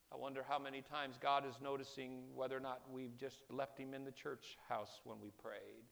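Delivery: ordinary talk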